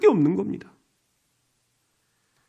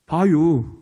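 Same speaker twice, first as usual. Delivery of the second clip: Chungcheong dialect; 3 semitones lower, much slower than usual. Shown as a demonstration. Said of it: "우리 충청도는".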